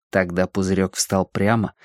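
usual speaking voice

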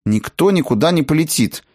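stern. A narrator's reading. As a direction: lay down the law to the family. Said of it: "строго сказала Клара". (строго, повышенным тоном)

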